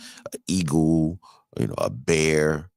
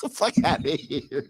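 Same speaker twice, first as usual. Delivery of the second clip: laughing; 7 semitones higher, 4 dB quieter than usual. Real, not acted. Laughing at own story.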